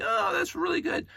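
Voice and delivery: leaping up high, playfully impressed; mock delighted praise. Mimicking Lego designers who aim for awesome, playfully delighted. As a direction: gush like someone wowed. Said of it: "They don't stop at the".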